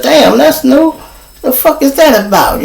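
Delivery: high-pitched baffled musing; incredulous high voice; retold bewilderment.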